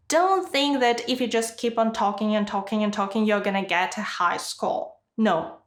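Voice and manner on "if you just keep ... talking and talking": fast, run-on stream of words; rapid nonstop talking. Shown as a demonstration. Teacher mimicking students who talk too fast, rapid run-on. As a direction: rattle it off without a pause.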